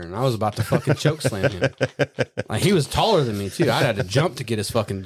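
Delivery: ordinary talk, nothing marked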